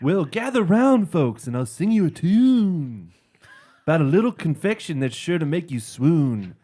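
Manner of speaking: loud folksy singer's pitch; [name] impression. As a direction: belt it like a folk troubadour. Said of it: "can you do a [name] voice".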